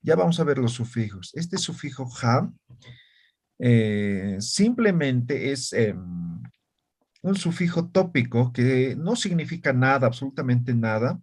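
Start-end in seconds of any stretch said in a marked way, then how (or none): none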